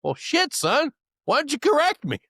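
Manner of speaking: high-pitched retold disbelief; incredulous instructor. Instructor to student who hid his real name, incredulous and high. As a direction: imitate a baffled instructor questioning his student.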